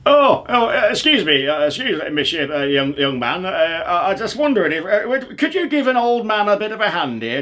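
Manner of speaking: put-on high, rambling old codger asking for help; old man asking a passer-by for a hand; doddery old man. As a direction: call out kindly and dither.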